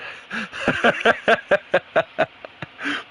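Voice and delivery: a long breathy laugh at himself; amused laughter. Real, not acted. Gamer laughing at his own British slang.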